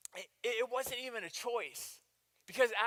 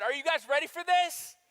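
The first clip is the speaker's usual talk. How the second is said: higher and louder, rallying the crowd; hype call; 8 semitones higher, 7 dB louder than usual. Real, not acted. Preacher rallying the congregation for a louder answer. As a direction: pump up the room. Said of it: "one more chance".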